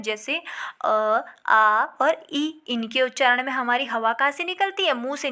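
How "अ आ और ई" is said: अ, आ and ई stretched very slowly through the mouth; slow oral vowel demo. Shown as a demonstration. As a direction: drag out अ आ ई with air only through the mouth. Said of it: "हवा केवल मुंह से निकलती है".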